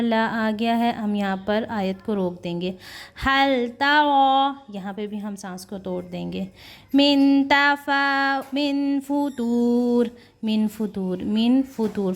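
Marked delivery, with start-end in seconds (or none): 3.3-4.6 slow, high, drawn-out Quranic chant; chanted recitation, higher and slow. Shown as a demonstration. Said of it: "Quran recitation demo". 6.9-10.1 slow, high, drawn-out Quranic chant; chanted recitation, higher and slow. Shown as a demonstration. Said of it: "Quran recitation demo".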